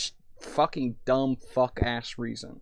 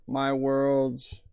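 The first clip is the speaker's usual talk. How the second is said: ordinary delivery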